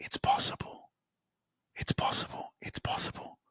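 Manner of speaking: soft repeated whisper; whispered affirmation. Shown as a demonstration. Host having listeners repeat the mantra, whispering. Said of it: "Just say it".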